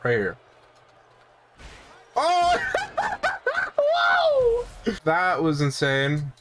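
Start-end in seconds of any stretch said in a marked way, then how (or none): 2.2-4.9 very high whoop through laughter; amazed outcry. Real, not acted. Gamer stunned by an insane moment, high laughing whoop.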